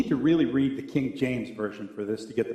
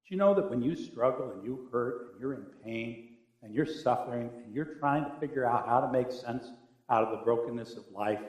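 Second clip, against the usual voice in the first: ordinary delivery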